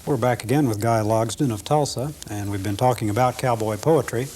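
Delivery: ordinary talk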